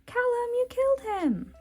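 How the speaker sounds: very high-pitched comic outcry; mock horror. Rayla scolding Callum over the guardian, mock horror. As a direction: cry it out high, aghast.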